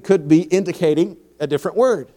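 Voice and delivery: ordinary delivery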